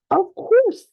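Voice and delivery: high cheery cartoon dad voice; very high playful Daddy Pig voice. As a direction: pitch way up as a jolly cartoon dad. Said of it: "says Daddy Pig".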